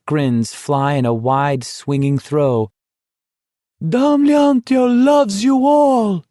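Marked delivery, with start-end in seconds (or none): 3.9-6.2 very high, loud, slow triumphant cry; joyful shout. Acted. Italian fruit seller shouting to the walkers as he falls, loud triumphant cry. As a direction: fling it to the crowd like a gift. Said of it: "he cried".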